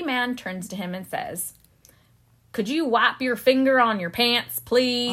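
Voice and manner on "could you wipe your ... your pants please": American Southern security man; Southern accent. Acted. Security man turns to the young brother at the gate, Southern accent. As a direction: put on a Southern drawl, slightly louder. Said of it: "I love it when you do that accent".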